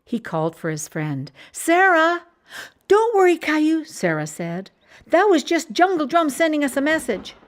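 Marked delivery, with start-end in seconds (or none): 1.5-2.2 put-on high, loud child's call; child calling his friend's name, much higher and louder. 2.9-7.3 high, loud cheerful reassurance; calm his fright playfully; Sarah reassuring Caillou, high and loud; reassuring girl. Acted.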